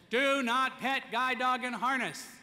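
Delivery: loud, high, slow laying down the rule; firm rule. Real, not acted. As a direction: drive each word home like a rule. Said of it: "It wasn't the time to give him a lecture".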